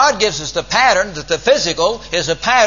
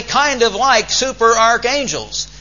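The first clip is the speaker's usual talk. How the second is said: quoted emphatically; 7 semitones higher than usual. Retold or imitated. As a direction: lift and slow it as his quoted words. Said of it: "that doesn't sound kind of like Mister Armstrong".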